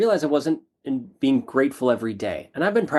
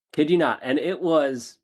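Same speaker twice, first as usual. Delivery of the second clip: ordinary talk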